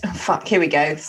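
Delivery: ordinary delivery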